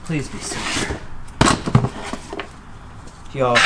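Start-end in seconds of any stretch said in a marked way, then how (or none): 0.0-1.0 soft unvoiced hopeful whisper; whispered plea. Real, not acted. Vlogger hopes the coins are silver, whispered plea. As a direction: breathe it like a wish.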